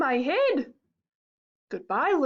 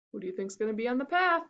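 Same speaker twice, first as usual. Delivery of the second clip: ordinary delivery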